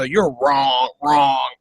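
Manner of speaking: slow, low, dismissive mimicry; Donald Trump. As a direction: stretch out the put-down, pitched low. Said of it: "I had to steal that".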